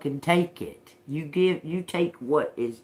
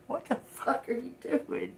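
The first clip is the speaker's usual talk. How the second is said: mock outrage; 10 semitones higher, 5 dB quieter than usual.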